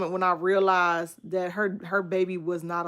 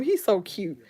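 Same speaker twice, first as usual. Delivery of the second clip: ordinary talk, nothing marked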